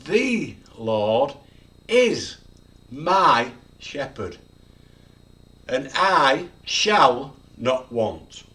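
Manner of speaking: slow and loud, "is", "my" and "shall" set apart; every word stressed. Shown as a demonstration. Preacher summing up the verse, every word stressed. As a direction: punch "is", "my" and "shall" with gaps between. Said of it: "You could emphasize every word".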